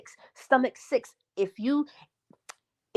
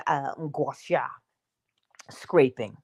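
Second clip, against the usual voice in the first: usual speaking voice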